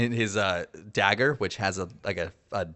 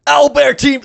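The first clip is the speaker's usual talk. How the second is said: much higher and louder rally call; commanding shout; 16 dB louder than usual. Acted. Squad leader calling his team over the radio, shouting. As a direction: bark the team's name.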